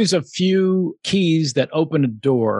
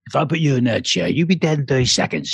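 quick, slurred London tough-guy accent; Jason Statham; faster than usual. Shown as a demonstration. Showing off a Statham impression to the host, fast slurred London accent. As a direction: run it together like a Cockney hard man. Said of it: "I had to learn Jason Statham".

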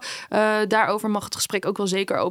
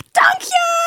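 high, bright-eyed and much louder, imitating the girl; cheerful thanks; 6 dB louder than usual. Girl thanking the guest at her party, cheerfully imitated. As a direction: chirp it back like a delighted girl. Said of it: "En die was helemaal vrolijk".

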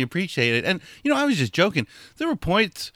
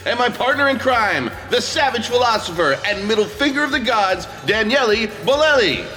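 announcer read; 9 semitones higher than usual. Acted. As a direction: hype up the episode like a radio host. Host reads show opener.

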